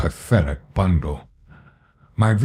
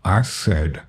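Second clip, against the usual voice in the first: ordinary delivery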